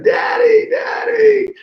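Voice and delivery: high, loud wailing call for Daddy; imitated crying child. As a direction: wail like a tearful little kid. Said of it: "he starts crying".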